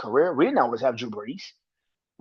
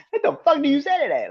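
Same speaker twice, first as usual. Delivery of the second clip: high, loud stunned disbelief; can't believe what he just heard; incredulous; 11 semitones higher, 4 dB louder than usual. Real, not acted. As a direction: viewers get a half-laughing outburst of disbelief. Stunned repeat, higher.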